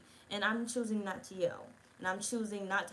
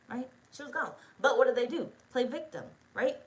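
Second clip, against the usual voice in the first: usual speaking voice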